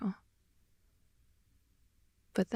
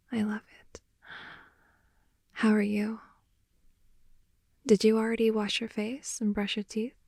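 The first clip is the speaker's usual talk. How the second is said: ASMR whisper. Acted. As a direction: whisper it close to the ear, winding down.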